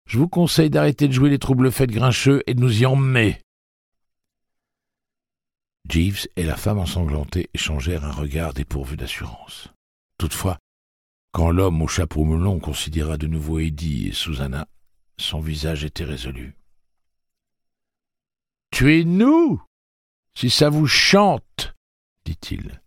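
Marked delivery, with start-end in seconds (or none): 0.0-3.4 raised, hard voice of a gunman; tough threat. A narrator's reading. Eddie threatening the bloodstained woman, hard and loud. 18.7-21.7 high, loud, slow defiant voice; resolute defiance. A narrator's reading. Jeeves defying Eddie's gun, high and slow.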